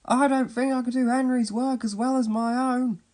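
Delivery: high, put-on voice of a reluctant engine; high-pitched vain engine character; James. A narrator's reading. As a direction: refuse in a high, self-important voice. Vain James refuses the work.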